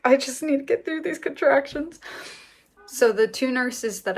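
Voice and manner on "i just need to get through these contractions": higher, absent-minded, talking to herself; tuning everyone out to get through it; distracted, inward mumble. Mother in labour ignoring the room, distracted and inward. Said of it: "just being like".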